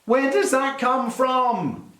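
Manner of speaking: high-pitched, incredulous protest; hammed-up exasperation; mock outrage. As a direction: rant it slowly in disbelief. Mock rant at American pronunciation.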